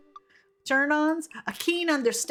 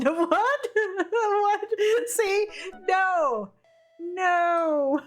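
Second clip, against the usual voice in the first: laughing disbelief; 8 semitones higher, much slower than usual. Real, not acted. Shocked by an absurd answer.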